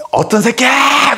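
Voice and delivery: slow, high angry shout; furious yell. Retold or imitated. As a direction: demand who it is, furious. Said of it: "막 이러는 거야".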